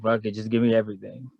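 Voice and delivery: ordinary talk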